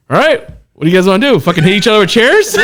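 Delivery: hyped-up quote. Retold or imitated. Telling the guys about the wrestling trainer, fast and laughing. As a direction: rattle it off like a wild trainer. Retelling Mikey's wild offer.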